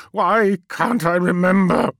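slow, high, loud cry; tormented question; anguished. Acted. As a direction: wrench the words out in despair. Magnus anguished at lost memory.

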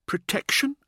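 high, tentative question; awkward junior. A narrator's reading. Junior answers the showboater's prompt, awkward and high. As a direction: offer the answer awkwardly, pitched well up. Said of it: "mumbled one of the juniors awkwardly".

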